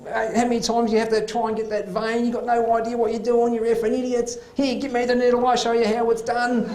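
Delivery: overdosed patient abusing the doctor over the needle, high angry rant; abusive yelling. Retold or imitated. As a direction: go off at the doctor like you've lost it. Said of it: "She's just yelling, abusing the staff".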